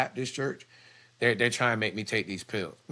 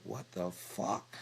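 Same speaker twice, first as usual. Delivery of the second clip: shocked whisper; 8 dB quieter, much slower than usual. Retold or imitated. Nurse shocked at the sight.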